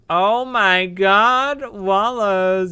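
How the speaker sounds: loud, high, slow cry of delight; freaking out. Real, not acted. Streamer spotting Wallows in game, loud delighted cry. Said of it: "I'm freaking out, guys".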